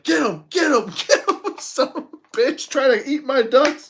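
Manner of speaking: high, loud reenacted shouting; retold frantic yelling. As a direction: yell the dogs on at an intruder, reenacting it. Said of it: "And I was like".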